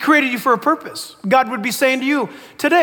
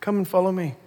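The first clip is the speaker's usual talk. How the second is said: gentle call; 4 semitones lower, 6 dB quieter than usual. Acted. Jesus calls Matthew gently.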